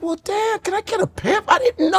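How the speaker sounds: put-on a high, meek child voice; meek kid reply. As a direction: squeak it out high and timid to dad. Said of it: "I was like".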